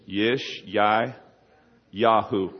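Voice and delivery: "Yish, Yai, Yahu", a pause before "Yahu"; syllable-by-syllable model for repeating; Hebrew pronunciation demo. Shown as a demonstration. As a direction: sound out "Yish, Yai, Yahu" in separate pieces. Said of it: "pronounced in Hebrew correctly is this".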